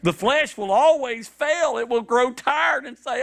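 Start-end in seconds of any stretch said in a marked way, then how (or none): none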